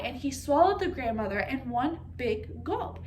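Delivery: plain reading voice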